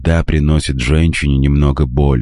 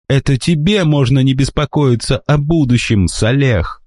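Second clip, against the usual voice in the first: high, raised outcry; exclaiming; 9 semitones higher than usual. Acted. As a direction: cry it out in protest. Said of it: "вскричала девушка".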